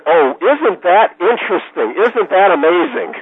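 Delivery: high, drawn-out feigned wonder; mock amazement. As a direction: act amazed at the obvious, slowly. Mock amazement at boss's remark.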